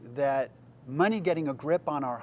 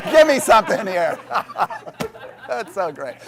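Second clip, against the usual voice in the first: laughing; 8 semitones higher, 10 dB louder than usual. Real, not acted. Pastor laughing at own joke.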